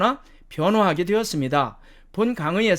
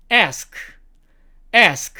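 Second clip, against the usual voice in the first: American "ask", front a as in "cat"; slow American demo of "ask"; American accent; 2 semitones higher, 4 dB louder than usual. Shown as a demonstration. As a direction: say "ask" slowly, American "esk". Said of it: "미국식".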